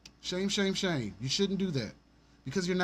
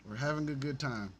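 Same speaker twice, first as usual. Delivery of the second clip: ordinary talk, nothing marked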